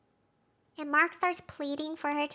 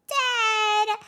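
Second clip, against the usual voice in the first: emphatic; 8 semitones higher, 9 dB louder than usual. Real, not acted. Punchline on Drummond.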